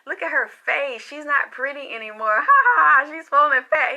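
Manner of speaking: imitated gloating laugh; imitating a man laughing at someone; mocking laughter. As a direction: jeer through loud, high mock ha-has. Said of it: "he was laughing".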